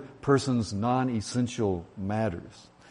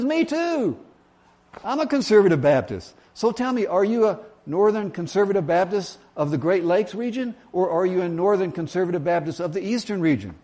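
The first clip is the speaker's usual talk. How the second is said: hammed-up, high-pitched excitement; overjoyed, settling into keen questioning; thrilled agreement; 6 semitones higher than usual. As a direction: squeal it high, then quiz eagerly. Joke character thrilled, quizzes further.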